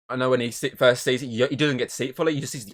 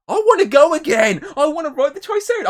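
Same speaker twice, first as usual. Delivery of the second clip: much higher and louder, pleading and eager; excited; 9 dB louder than usual. Real, not acted. Begging to go back to park.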